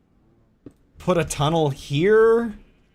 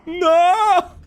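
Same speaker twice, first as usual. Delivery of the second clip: sudden high yelp of denial; loud cry; 8 dB louder than usual. Real, not acted. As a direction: shriek it out. Reacting to chat.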